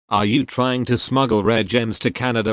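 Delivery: usual speaking voice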